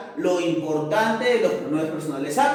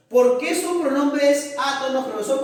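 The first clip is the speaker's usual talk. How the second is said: higher pupil voice asking the profesor; student asking; 6 semitones higher than usual. Acted. Teacher voicing a pupil asking about átonos, higher. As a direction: play the curious student.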